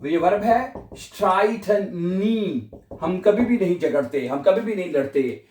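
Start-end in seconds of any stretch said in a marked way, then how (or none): none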